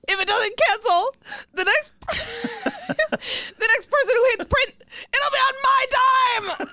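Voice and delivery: high frantic laughing cry; panicked whine. Retold or imitated. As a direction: blurt it high, near tears. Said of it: "He's like".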